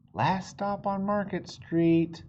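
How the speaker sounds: slow called-out stop announcement; driver calling out. Acted. Bus driver announcing the last stop to passengers, slow call. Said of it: "Mister Dennis called".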